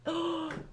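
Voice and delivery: sharp whispered gasp of pain. Real, not acted. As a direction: gasp as the cramp bites. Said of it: "I'm getting a foot cramp".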